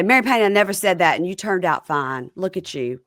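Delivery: lightly voiced Southern mom brushing off a complaint; Southern accent. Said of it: "I'm doing Southern".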